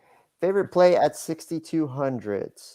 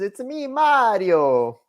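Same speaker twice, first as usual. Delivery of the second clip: high, slow Mario catchphrase with a broad ah in Mario; Mario impression; 8 semitones higher, 4 dB louder, slower than usual. S1 showing how the game character really says it, high and slow. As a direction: drag out the plumber's catchphrase, MAH-rio. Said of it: "He says".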